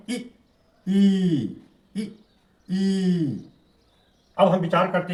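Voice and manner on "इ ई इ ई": brief इ, long sustained ई; short-long vowel drill. Shown as a demonstration. Teacher models reading for children, short इ and long ई. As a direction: clip इ, then hold ई out evenly, twice. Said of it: "इसको इस तरह से पढ़ें".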